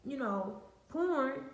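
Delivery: high, disbelieving outrage; you can't be serious; incredulous. Retold or imitated. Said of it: "I'm like".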